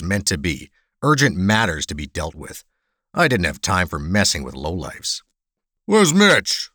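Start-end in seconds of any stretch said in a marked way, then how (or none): 5.8-6.7 loud, high-pitched thug's demand. Acted. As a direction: demand it loudly from the bartender. Hulking thug demands an answer.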